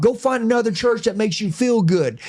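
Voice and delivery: high-pitched, hard-driving preacher impression; yelling preacher. Preacher challenging his congregation with hard truth, fired-up preacher voice. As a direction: imitate a blunt preacher working the room. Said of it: "preacher talk just like this".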